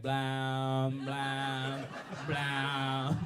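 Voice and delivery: drawn-out, monotone "blah" droned like a toy; mimicking a buzzing toy. Comedian demonstrating his toy imitation, droned blahs. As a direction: imitate a toy's steady drone. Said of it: "I'm trying to mimic the toy".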